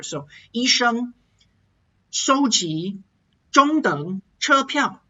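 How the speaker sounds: 收集 中等 车票 said carefully in clear tones; 双音节声调示范; two-tone combination demo. Shown as a demonstration. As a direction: model each two-tone word slowly. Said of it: "training your tones in two-tone combinations".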